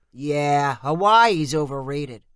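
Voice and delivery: slow, dismissive uncle voice. Acted. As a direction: shrug it off like a grumpy uncle.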